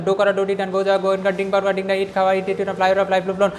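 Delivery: quick, even memorising chant, no pauses between words; rapid rote recitation. Shown as a demonstration. Teacher shows students how to memorise verbs, rapid rote chanting. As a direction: run the word lists together in a fast monotone. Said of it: "এভাবে শুরু করতাম".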